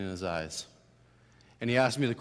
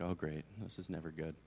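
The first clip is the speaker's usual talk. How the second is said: sarcastic dread; 8 semitones lower, 16 dB quieter than usual. Retold or imitated. Dread on being called to office.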